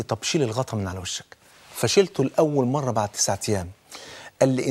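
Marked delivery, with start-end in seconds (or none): 0.0-1.2 lower, much softer order; softened officer. Retold or imitated. Officer quietly telling the blindfolded prisoner to uncover, soft. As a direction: give the order quietly, the anger gone. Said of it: "فقال لي".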